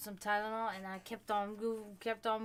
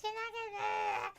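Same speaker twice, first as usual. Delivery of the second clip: high, teasing imitation of the bikers; mocking the bikers; 13 semitones higher than usual. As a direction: pitch up and needle her like a pushy biker. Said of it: "they're gonna be like".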